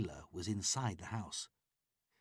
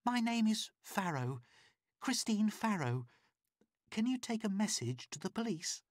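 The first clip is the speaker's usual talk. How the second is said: much higher, urgent woman's voice; higher pleading woman; pleading captive woman; 13 semitones higher than usual. A narrator's reading. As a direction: beg for help through the door. Christine names herself.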